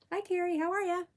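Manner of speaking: very high, loud greeting; high-pitched hello. Real, not acted. Streamer welcoming a viewer, voice up very high. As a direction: shoot the voice way up to say hello. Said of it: "my voice goes up so high".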